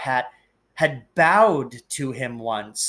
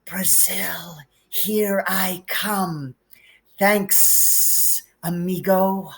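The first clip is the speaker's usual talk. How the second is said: breathy whispered snake hiss; 5 semitones higher, much slower than usual. Acted. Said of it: "a low, hissing voice said".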